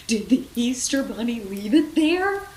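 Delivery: high, loud, slowed fearful question; panicky. Acted. Rex fearing the bunny left the grass, panicked and high. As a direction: ask it trembling with dread. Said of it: "in a panic".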